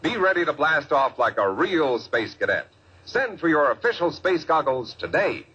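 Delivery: ordinary talk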